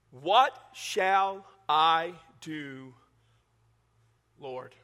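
What shall I do, Lord? drawn out very slowly, with long pauses; slow, weighty reading. Shown as a demonstration. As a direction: read it slow so they don't miss it. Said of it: "if you just read it too fast, you miss this".

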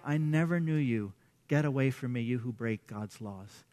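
low, subdued pronouncement; solemn rejection. Acted. Lord turning away lawbreakers, low and solemn. Reads Lord's rejection of false followers.

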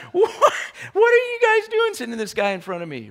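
high, laughing disbelief; Goliath laughing at the boy; mocking Goliath. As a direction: scoff like a giant amused.

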